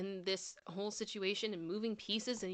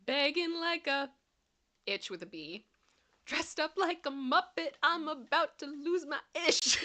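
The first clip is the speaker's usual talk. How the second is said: higher, slow, hammed-up outraged singer voice; mock song villain; 7 semitones higher, 4 dB louder, slower than usual. Quoting the lamp song guy to the chat, hammed-up and slow. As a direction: ham up the furious Halloween song guy. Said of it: "And it's like".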